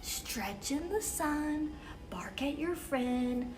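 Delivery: ordinary delivery, nothing marked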